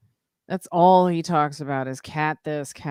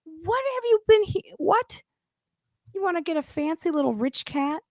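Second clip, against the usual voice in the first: much higher, teasing incredulity; mocking disbelief; 12 semitones higher than usual. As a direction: poke fun at her fancy taste, pitched way up. Mocking the bougie friend.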